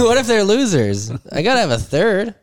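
high, hammed-up worry; mock panic. Host fearing all three teams lose, mock panic. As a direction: escalate the pretend dread.

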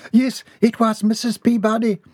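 high-pitched huffed reply; high, flustered woman's voice; huffing. A narrator's reading. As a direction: huff it out in a high voice. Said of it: "Missus Dixon huffed".

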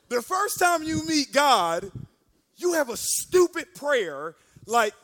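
slow, loud self-rebuke; mock scolding. Young man scolding himself after church, slow and loud. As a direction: stretch each word in loud disbelief.

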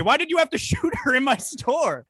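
high-pitched incredulous outcry; shocked disbelief. Acted. Store owner confronting a customer over a shooting, shrill disbelief. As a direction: squeal the question in mock horror.

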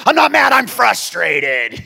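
loud, slow, tight-lipped denial; a father holding his temper in; strained dad. Retold or imitated. Pastor dad insists to his son he's only frustrated, loud and slow.

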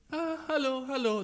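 high, soft stiff royal hallo; robot queen greeting. Pretend. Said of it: "Der winkt einfach so".

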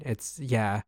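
normal talking voice

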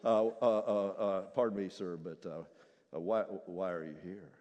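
very low, soft, hesitant; senior angel. Acted. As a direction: stammer politely. Senior angel nervously asks.

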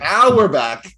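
loud, high, happy shout; excited cheer. Real, not acted. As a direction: cheer it out, we're back in it. Repeats cheer.

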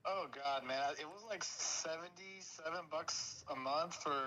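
normal talking voice

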